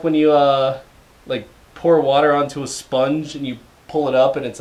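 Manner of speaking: normal talking voice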